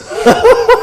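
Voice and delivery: high loud disbelieving laugh; burst of laughter. Real, not acted. Laughing at the thief's hellfire and lead threat. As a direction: crack up at the threat.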